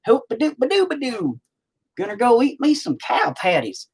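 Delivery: over-the-top country bumpkin voice. Retold or imitated. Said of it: "I don't have the accent where I'm like".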